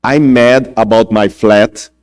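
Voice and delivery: slow, careful English line; English quote. Shown as a demonstration. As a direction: say it slowly in English. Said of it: "quando você fala assim". (frase em inglês, devagar)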